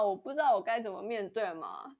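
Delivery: ordinary talk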